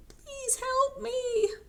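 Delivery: tiny, very high, slow voice; soft plea. Acted. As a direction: plead in a small squeak. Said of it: "then very softly".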